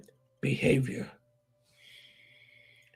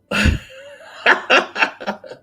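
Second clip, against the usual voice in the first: a real high burst of laughter, a little louder; 9 semitones higher, 5 dB louder than usual. Real, not acted. Vlogger laughing at the woman's photo, high laugh. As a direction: laugh out loud, high and breathy. Laughing over her picture.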